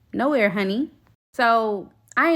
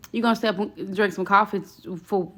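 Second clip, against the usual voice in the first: normal talking voice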